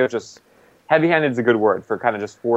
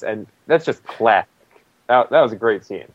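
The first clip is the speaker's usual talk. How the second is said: ordinary talk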